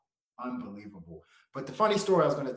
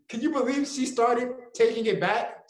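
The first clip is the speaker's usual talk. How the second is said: ordinary talk, nothing marked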